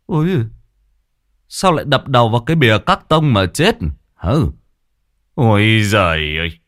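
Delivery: a hint of a deaf old man exclaiming; hard of hearing old man. Old Cẩm mishears Mai, lightly voiced deaf old man.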